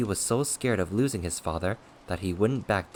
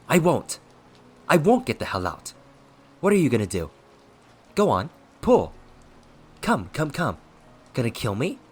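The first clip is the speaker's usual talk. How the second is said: loud, high, goading refusal; defiant shout; 7 semitones higher, 8 dB louder than usual. A narrator's reading. Possessing ghost refusing to leave, loud defiant goading. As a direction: dare him at full voice.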